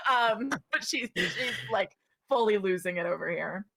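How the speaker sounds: normal talking voice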